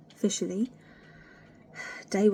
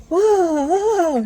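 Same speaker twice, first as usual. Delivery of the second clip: high, loud startled whoa; retold yelp; 10 semitones higher, 12 dB louder, much slower than usual. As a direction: yelp in shock at the sensation. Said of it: "And I went".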